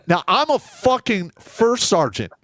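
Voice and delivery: usual speaking voice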